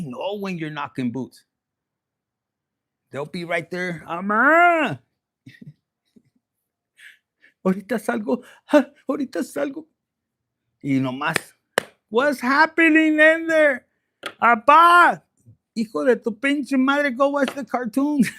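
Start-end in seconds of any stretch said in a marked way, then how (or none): 4.1-9.9 put-on kid calling out. Kid calls, parent answers from bedroom. 12.1-18.3 put-on high, loud, playful yell at the kid; mock yell. Parent yelling at the kid through the bedroom door, mock angry.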